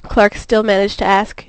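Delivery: usual speaking voice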